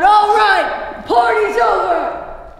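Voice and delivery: loud, high commanding shout; big drawn-out yell to break it up. Retold or imitated. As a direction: holler it across a noisy saloon. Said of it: "I turn around and I yell out".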